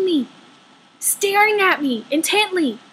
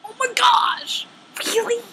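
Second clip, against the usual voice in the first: exasperated whisper; 7 semitones higher than usual. Retold or imitated. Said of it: "And I'm like".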